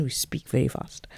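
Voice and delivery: whispering a joke to the listener; whispered aside. Real, not acted. Jokey aside about speaking fast.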